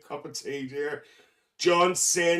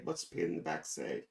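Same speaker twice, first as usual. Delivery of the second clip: whispering; 13 semitones lower, 13 dB quieter, faster than usual. Real, not acted. Muttered aside.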